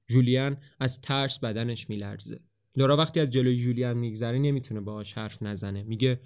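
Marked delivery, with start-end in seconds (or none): none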